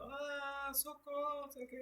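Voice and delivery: high falsetto cry for help, kept soft; half-hearted squealed plea in falsetto; mock damsel scream. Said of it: "a moça gritando".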